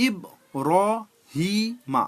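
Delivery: "ইব র হি মা" with a pause after each syllable; four separate syllables. Shown as a demonstration. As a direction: say "ইব র হি মা" one syllable at a time. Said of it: "চারটে syllable ভাগ করে".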